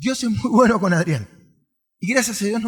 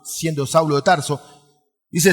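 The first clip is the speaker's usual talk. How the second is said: ordinary talk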